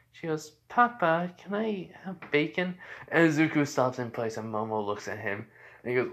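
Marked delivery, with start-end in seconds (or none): 0.7-2.7 put-on high little-girl voice; Eri. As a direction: ask sweetly, pitched up like a small child. Little girl asks her papa.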